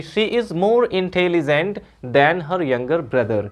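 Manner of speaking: very slow, each word given weight; slow reading. Shown as a demonstration. Teacher reading out the answer sentence slowly. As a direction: read the finished sentence out slowly. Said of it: "positive से comparative में change हो गया कि".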